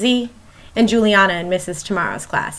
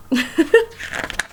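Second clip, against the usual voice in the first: soft laugh; 5 semitones higher, 3 dB quieter than usual. Real, not acted. Laughs at snowbanks punchline.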